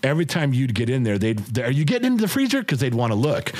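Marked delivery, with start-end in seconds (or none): 1.6-2.6 put-on high, fast child voice; kids' eager question. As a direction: chirp it like a curious kid, lightly. Kids asking about freezer.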